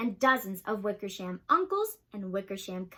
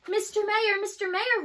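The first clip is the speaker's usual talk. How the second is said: high calling to someone far below; 6 semitones higher than usual. A narrator's reading. Horton calling down to the mayor on the speck. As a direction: call down to the tiny mayor. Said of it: "Horton called".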